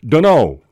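"dunno" run together, loud; phonetic shortcut. Shown as a demonstration. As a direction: punch out a slurred "dunno". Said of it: "If you use gonna for going to".

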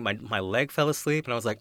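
ordinary talk, nothing marked

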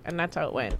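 usual speaking voice

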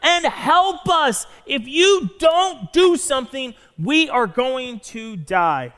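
screaming disciples. Acted. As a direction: yell for help as the boat sinks. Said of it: "they scream out".

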